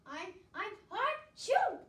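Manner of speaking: loud drawn-out sneeze that breaks the name; big sneeze. Acted. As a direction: sneeze it out loudly. Sneezy sneezes mid-introduction.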